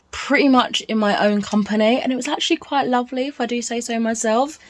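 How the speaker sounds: ordinary talk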